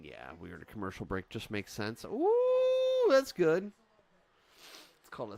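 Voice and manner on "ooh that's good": very high, loud delighted ooh; squealing ooh of delight; excited ooh. Real, not acted. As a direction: thrill at the move. Wrestling move excitement.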